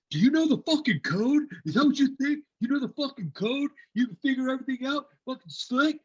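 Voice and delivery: high, loud mocking yell; angry yelling. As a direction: yell it like an angry squad leader. Retold squad leaders yelling.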